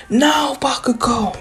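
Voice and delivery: high-pitched, drawn-out mock shout. Izuku calling to Bakugo mid-fight, shouting. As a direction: cry it out high and slow. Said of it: "Then Izuku shouts".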